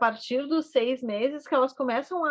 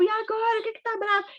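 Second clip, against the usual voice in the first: put-on baby talk; 11 semitones higher, faster than usual. Shown as a demonstration. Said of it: "se eu digo".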